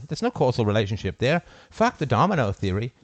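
usual speaking voice

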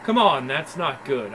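usual speaking voice

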